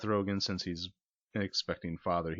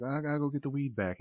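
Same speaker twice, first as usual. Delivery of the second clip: mock frantic; 9 semitones higher, 5 dB louder than usual. Chasing the thief.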